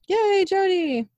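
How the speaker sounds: high, drawn-out fan cheer; long, high-pitched yay; delighted cheer. Real, not acted. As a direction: stretch it out high, cheering her on. Cheering a favorite character's arrival.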